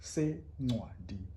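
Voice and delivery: Sencwadi very slow with the c click; isiXhosa click. Shown as a demonstration. Teacher modelling Sencwadi slowly. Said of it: "of the book".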